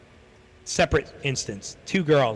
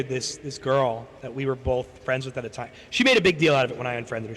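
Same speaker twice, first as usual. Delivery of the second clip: ordinary talk, nothing marked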